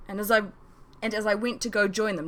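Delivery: ordinary delivery, nothing marked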